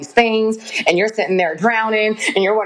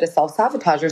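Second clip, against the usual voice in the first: ordinary talk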